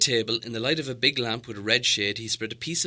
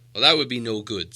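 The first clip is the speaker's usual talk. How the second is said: the wizard reasoning, a little louder; patient wizard; 8 dB louder than usual. Acted. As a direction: calmly explain why Smaug can't fit. Gandalf replies.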